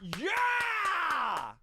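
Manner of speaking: long, high-pitched "yeah" yell; loud cheer. Real, not acted. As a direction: holler a drawn-out cheer. Cheering the top five topic.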